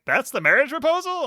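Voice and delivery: high, disbelieving question tailing into dismay; incredulous. Retold or imitated. Podcaster reacts to the marriage proposal, incredulous. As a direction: balk at it in high-pitched disbelief.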